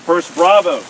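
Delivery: loud drill call; calling from far away. Real, not acted. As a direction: shout it across the field. Calling out orders in field.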